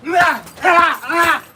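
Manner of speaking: sharp bursts of effort on every strike; punch exertion cries. Real, not acted. Boxer throwing punches in training, effort cries. Punching through a workout round.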